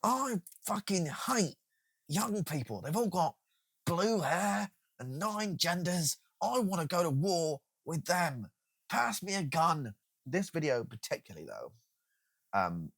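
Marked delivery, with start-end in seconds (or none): 0.0-9.9 slow, dragged-out mock rant; hammed-up slow grumbling, somewhat higher; mocking grumpy boomer. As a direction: parody an old man raging at youth. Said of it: "Everyone's like".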